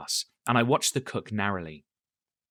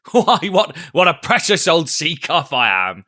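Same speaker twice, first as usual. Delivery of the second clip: laughing; 6 semitones higher, 9 dB louder than usual. A narrator's reading. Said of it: "he said at last, wiping his cheeks".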